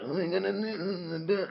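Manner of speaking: caricatured drawn-out mumble; mumbling character voice. Retold or imitated. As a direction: slur it in a put-on high voice. Botched character voice, Montgomery.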